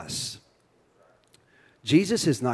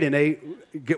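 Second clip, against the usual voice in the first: normal talking voice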